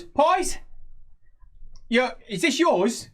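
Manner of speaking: high-pitched little pie seller; halfling merchant. Acted. Halfling merchant to dog's owner, high questioning voice. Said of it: "to the halfling mer-, uh, merchant".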